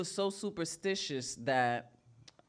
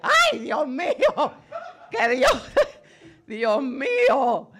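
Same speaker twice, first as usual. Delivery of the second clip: much higher, louder laughing outcry; shocked shriek; 12 semitones higher, 13 dB louder, slower than usual. Retold or imitated. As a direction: shriek in mock horror. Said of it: "she'd be like".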